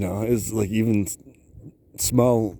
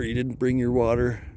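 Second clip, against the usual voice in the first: ordinary talk, nothing marked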